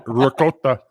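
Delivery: put-on "ricotta" as ri-KO-ta, with a light Italian accent; a mock-Italian "ricotta" like a chef would say it. Friend corrects himself, saying "ricotta" in a light Italian accent. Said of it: "As Giotto would say".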